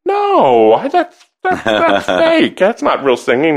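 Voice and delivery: squeaky high, slow denial; a girlish high protest that it's fake; retelling her bashful protest to the choir director, very high voice; embarrassed protest.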